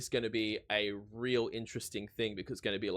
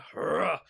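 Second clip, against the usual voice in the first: short, loud imitated "hurrah" burst; imitated rough singing tone; 6 semitones higher, 5 dB louder than usual. Showing viewers how the singer came in, imitated burst. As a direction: mimic the singer's punchy rock attack. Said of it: "came in with that kind of".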